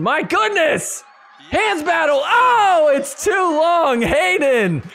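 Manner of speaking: shouting high with excitement, stretching the words; excited. Real, not acted. Commentator yelling at a wild rally, excited. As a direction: yell it out as the rally ends.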